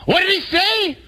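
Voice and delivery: high, straining holler; comic bewildered yell; yelling over wind. Acted. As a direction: shout as if you can't hear a thing. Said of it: "he has to yell back".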